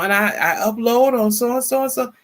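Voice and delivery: ordinary talk, nothing marked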